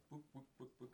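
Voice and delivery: high clucking hen going book book book; chicken clucking. Acted.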